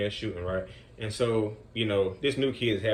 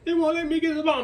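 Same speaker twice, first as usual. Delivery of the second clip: put-on high whiny child voice, louder; kid's voice; 5 dB louder than usual. Upset boy complaining to the coach, high whiny kid voice. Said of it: "And he was like".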